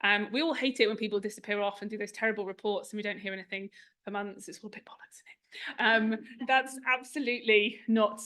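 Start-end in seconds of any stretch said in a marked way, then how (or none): 4.5-5.4 near-whispered cheeky confession; hushed aside. Real, not acted. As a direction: drop right down for the rude word. Cheeky swear aside to audience.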